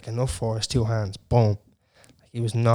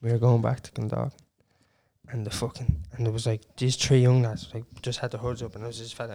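ordinary talk, nothing marked

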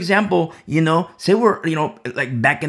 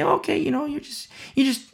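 mock friend voice; 9 semitones higher than usual. Friends voiced, urging drink.

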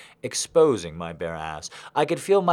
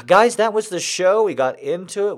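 much higher and louder; excited wrap-up; 10 semitones higher, 8 dB louder than usual. Real, not acted. Host thanking the crowd at show's end, excited. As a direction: burst in, thrilled with the night.

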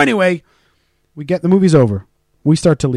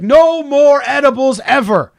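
slow, high and loud declaration; telling his wife after waking up, mock yell; 9 semitones higher, 4 dB louder, slower than usual. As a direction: bellow it like a stoned vow. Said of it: "And I was like".

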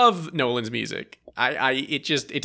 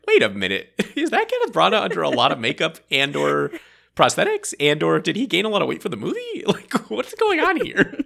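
higher, louder, laughing disbelief; incredulous double take; 7 semitones higher, 3 dB louder than usual. Retold or imitated. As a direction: act baffled as it dawns on you. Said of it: "I was like".

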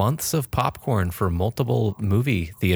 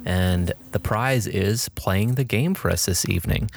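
normal talking voice

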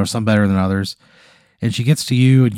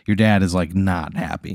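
ordinary delivery

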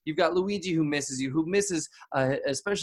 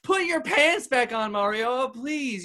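higher, slow pleading; 7 semitones higher, slower than usual. Real, not acted. Host begs Marioa to dress again, in a high slow plea. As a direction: beg them to stop.

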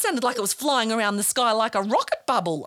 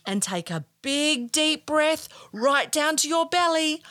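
guided breathing; 4 semitones higher than usual. Acted. Guides children's breathing exercise.

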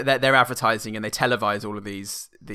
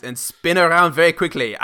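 loud, laughing read-back; 4 semitones higher, 6 dB louder than usual. Pretend. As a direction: read the line back aloud. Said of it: "Read that back to me".

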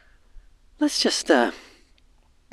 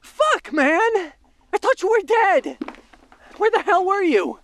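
excited relief; 14 semitones higher than usual. Acted. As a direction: burst out in relief. Relieved friend alive.

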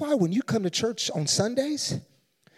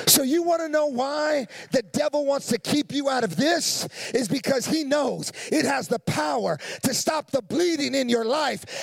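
preaching at full force; 5 semitones higher than usual. Acted. Preacher shouting to the congregation about stopping the bleeding. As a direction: lift the room, shouting the promise.

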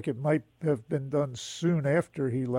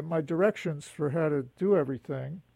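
ordinary talk

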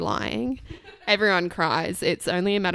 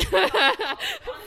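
laugh. Real, not acted. Laughing at own story.

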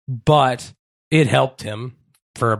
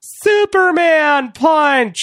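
high, drawn-out commentator's yell; Michael Cole; 4 dB louder, much slower than usual. Acted. Host mimicking a wrestling announcer calling a move, high excited yell. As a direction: call the big move in a frantic high holler. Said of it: "That's my impression of Michael Cole calling out".

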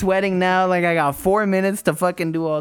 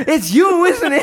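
laughing accusation; 11 semitones higher, 6 dB louder than usual. Retold or imitated. Bully accusing him again.

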